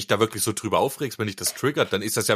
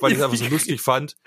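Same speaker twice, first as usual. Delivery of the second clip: usual speaking voice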